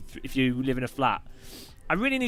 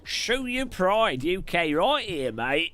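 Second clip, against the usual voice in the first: hammed-up Cockney voice; playful Cockney impression; mock London accent; 2 semitones higher, slower than usual. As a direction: lay on a thick London accent. Put-on London accent bit.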